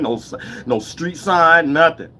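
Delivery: normal talking voice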